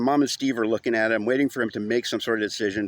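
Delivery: ordinary delivery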